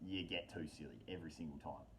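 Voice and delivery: ordinary talk, nothing marked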